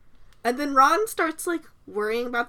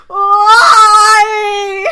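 high, loud howl of "Why?"; tantrum wail; 9 semitones higher, 16 dB louder than usual. Acted. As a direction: scream it like Johnny in The Room. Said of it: "He throws basically that fit".

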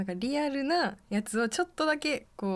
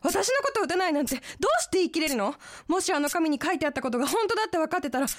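frantic accusation; 9 semitones higher, much faster than usual. Acted. Drama: accusing Ken in panic.

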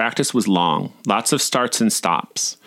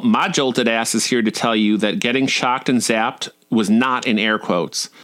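ordinary delivery, nothing marked

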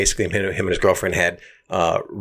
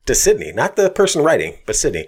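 ordinary talk, nothing marked

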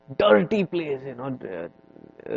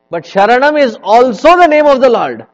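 emphatic declaration; 10 semitones higher, 14 dB louder than usual. Real, not acted. Emphatic sermon point.

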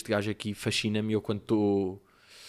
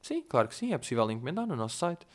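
imitated woman on the restaurant line; phone operator; 5 dB quieter than usual. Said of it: "Ela tipo".

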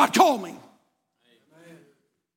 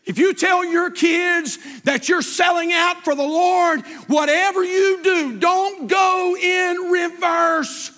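slow shouted preaching; 10 semitones higher, much slower than usual. Acted. Pastor urging parents to follow through, slow shouted plea. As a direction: drive each word home at full force.

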